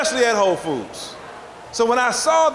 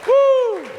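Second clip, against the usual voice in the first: loud high whoop; 3 dB louder than usual. Real, not acted. Closing cheer to crowd.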